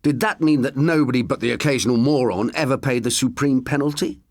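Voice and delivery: usual speaking voice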